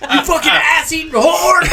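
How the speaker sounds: high laughing shouted insult; hammed-up high yell; mock tic yell. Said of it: "he's just yelling shit like".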